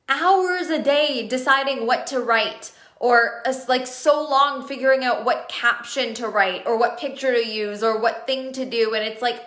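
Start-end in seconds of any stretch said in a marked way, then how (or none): none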